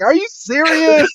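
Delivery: hammed-up incredulous friend; mock outrage. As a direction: shriek the question in mock shock. Friend reacting in acted quarrel.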